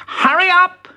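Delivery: very high, loud urging; urgent hurrying. Acted. Bertie hurrying the rabbits out, high urgent call. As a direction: chivvy them along loudly.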